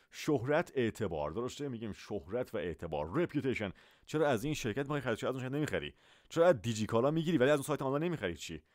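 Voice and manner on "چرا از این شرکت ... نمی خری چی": normal talking voice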